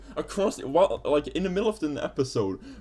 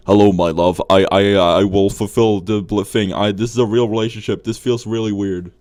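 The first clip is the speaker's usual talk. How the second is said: much lower and much louder, a hammed-up lover's voice; mock romantic hero; 7 semitones lower, 11 dB louder than usual. Friend parodies the cartoon boy greeting his girlfriend, deep loud mock romance. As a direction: boom it out like a cheesy romantic lead. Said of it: "he's like".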